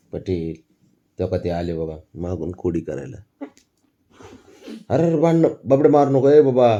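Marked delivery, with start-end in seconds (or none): 4.9-6.7 much higher, louder call to stop; alarmed shout. Real, not acted. Parent shouting to stop a fight.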